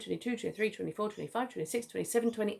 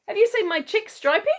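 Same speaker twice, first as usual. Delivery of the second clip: anxious emu dad; 12 semitones higher, 6 dB louder, faster than usual. A narrator's reading. Father calling to kookaburras.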